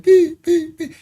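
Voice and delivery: high-pitched mock beeps; high monitor beeps. Imitating the monitor as the baby slips back, high beeps.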